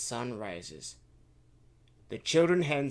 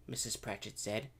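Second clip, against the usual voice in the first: plain reading voice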